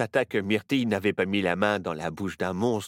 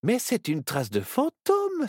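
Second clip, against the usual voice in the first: higher voice, thrilled by the discovery; excited exclamation; 3 dB louder than usual. A narrator's reading. Myrtille recognizes a ghost trail: higher, excited exclamation. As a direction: announce a ghost trail with delight. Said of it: "s'exclama-t-elle".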